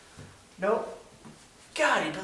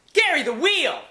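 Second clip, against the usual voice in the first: loud high call across room; 11 semitones higher, 6 dB louder than usual. Acted. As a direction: call him in loudly. Calling for the puppet.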